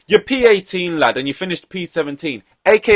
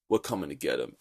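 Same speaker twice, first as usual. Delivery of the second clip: much lower, softer mock threat; hushed mock threat; 13 semitones lower, 13 dB quieter, faster than usual. Mocking Ocon's threat to the Haas cars, lower and hushed. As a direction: mutter it like a dark promise. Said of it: "Ocon being like".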